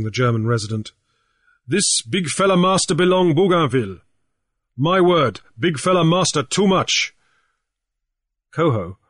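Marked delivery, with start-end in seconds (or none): 1.7-7.1 raised, lively pidgin English; Grief's pidgin voice. A narrator's reading. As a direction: talk big to the old chief in pidgin. Grief introduces German in pidgin.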